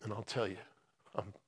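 halting, hushed, with long pauses; emotional. Real, not acted. Preacher sharing recent events in his life, emotional. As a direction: speak softly and haltingly, holding back feeling. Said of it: "I get all emotional about this stuff".

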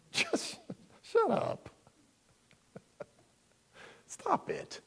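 high, strained mock scolding; mock exasperation. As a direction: act fed up with petty critics, squeezing the words out high. Voicing Jesus scolding petty critics.

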